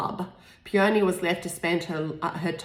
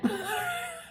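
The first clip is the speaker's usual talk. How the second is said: laughing at herself; 7 dB quieter than usual. Real, not acted. Laughs at own accent attempt.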